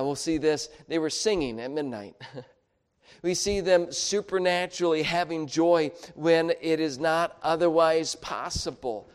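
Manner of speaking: usual speaking voice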